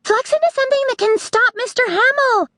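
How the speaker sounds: put-on high, fast urgent girl voice. A narrator's reading. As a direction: rush out the plea. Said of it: "One of the girls said".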